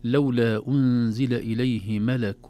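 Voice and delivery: لولا أنزل إليه ملك recited slowly and loud. Shown as a demonstration. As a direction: chant the verse out clearly. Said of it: "انزلوا تحت شوي في الآية السابعة".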